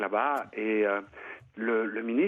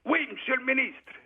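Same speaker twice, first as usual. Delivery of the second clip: high, clipped soldier's reply to a superior; soldier answering; 11 semitones higher than usual. Retold or imitated. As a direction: bark it up high like a para at attention. Para answers minister.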